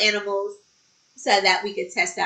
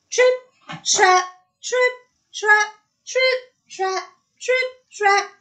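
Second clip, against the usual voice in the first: high, separated stepping words; marching hoof beats; 11 semitones higher, 3 dB louder than usual. A narrator's reading. Biggest goat crosses the bridge, in high stomping beats. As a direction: pound out each word high, like a hoofstep.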